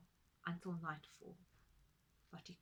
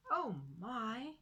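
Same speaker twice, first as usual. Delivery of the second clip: usual speaking voice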